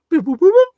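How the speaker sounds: high, loud mock beeps, one after another; quick boops. Podcaster mimics Tampa's drive with high beeps. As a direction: tick off the plays with beeps.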